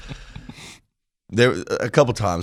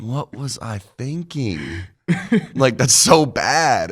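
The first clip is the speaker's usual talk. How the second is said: slow rueful disbelief; 6 semitones higher, 4 dB louder, much slower than usual. Retold or imitated. Cringing at his childhood self.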